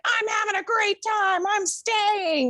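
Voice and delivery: very high, sing-song tipsy voice; high giddy mock of own drunk self; drunk party self. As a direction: squeal it like a happy drunk. Drunk self quoted refusing.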